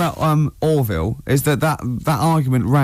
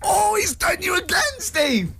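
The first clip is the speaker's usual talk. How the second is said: gleeful shout; 3 dB quieter than usual. Real, not acted. Teasing Steve, calling out.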